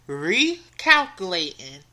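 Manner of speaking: low, slow robotic navigation voice; phone GPS voice. Retold or imitated. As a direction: imitate a GPS robot reading the word slowly. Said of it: "Phone says".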